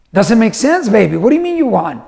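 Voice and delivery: high, incredulous dad questioning; puzzled. Retold or imitated. Dad questions daughter's claim of winning, puzzled. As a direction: question the child in playful disbelief.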